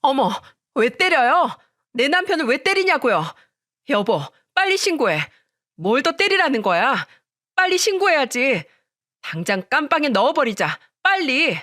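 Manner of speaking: high, scandalized carrying-on; fussing, frantic outrage. A narrator's reading. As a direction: make a big indignant fuss, urging action. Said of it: "동서의 호들갑을 보면서".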